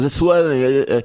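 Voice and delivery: imitating a kid with a swollen tongue; mock thick-tongued struggle to get words out; talking funny. Son telling dad about his tongue, talking funny. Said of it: "now you're talking funny".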